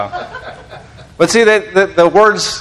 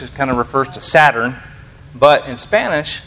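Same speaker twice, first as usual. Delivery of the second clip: ordinary talk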